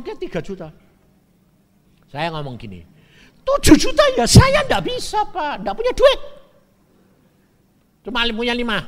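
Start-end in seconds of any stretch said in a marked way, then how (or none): 3.4-8.8 loud pleading. Retold or imitated. Buyer pleads he has no money.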